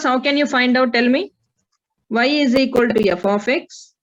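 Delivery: ordinary delivery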